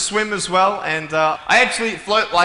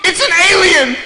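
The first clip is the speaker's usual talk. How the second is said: put-on much higher, loud child's cry to her mother; scared little girl; 13 semitones higher, 8 dB louder than usual. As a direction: shout it like a frightened little girl. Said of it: "and she goes".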